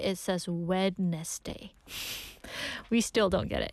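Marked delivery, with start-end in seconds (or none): 0.5-1.7 "Wednesday" slowly, as it is spelt; careful spelling pronunciation. Shown as a demonstration. Host explaining a weird spelling, careful slow "Wednesday" as written. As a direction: sound out every part of "Wednesday". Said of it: "if we read it, like, carefully".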